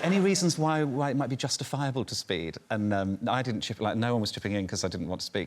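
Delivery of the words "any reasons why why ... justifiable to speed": patronising. Retold or imitated. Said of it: "he's really patronising".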